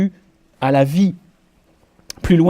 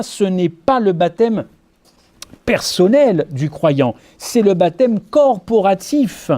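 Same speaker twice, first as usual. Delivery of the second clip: slow emphatic contrast; 3 semitones higher, slower than usual. Acted.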